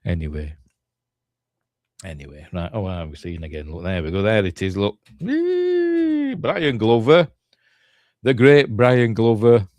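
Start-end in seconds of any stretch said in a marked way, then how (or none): 5.2-6.3 high-pitched drawn-out whee; playful squeal. Real, not acted. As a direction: let out a long, very high squeal. Spots something on screen, playful noise.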